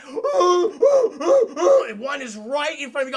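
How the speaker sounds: loud gasp then slow, loud telling; big gasp. Real, not acted. Telling chat the jump scare, gasping loudly and slowing down. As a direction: gasp and drag out the reveal.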